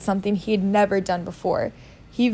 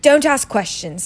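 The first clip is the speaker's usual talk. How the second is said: raised, emphatic rebuke; snapping; 5 semitones higher, 4 dB louder than usual. Acted. Uncle cuts off the boy's question, snapping. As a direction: snap it with each word weighted. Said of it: "snapped his uncle".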